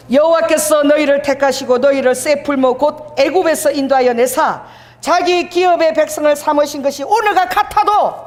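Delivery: declamatory reading, pitch climbing at the end; scripture reading. Acted. Preacher leads congregation reading the verse, declamatory scripture reading.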